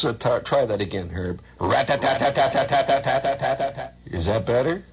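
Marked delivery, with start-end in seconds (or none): 1.6-4.0 rat-tat-tat gun sound with the mouth; spray the room with a mock machine gun; playful mouth machine gun; machine gun noise. Imitating machine gun fire.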